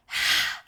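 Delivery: big loud sigh through the mouth; forceful sigh. Shown as a demonstration. Teacher exhaling with the class, loud forceful sigh. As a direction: push the breath out hard.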